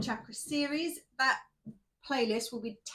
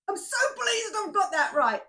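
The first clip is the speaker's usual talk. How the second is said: higher and louder, pleased with herself; delighted; 10 semitones higher, 6 dB louder than usual. Real, not acted. Vlogger thrilled she finally says the viewer's name right, delighted. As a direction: beam out the relief of getting it right.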